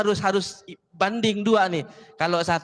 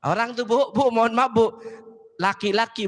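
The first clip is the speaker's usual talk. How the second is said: normal talking voice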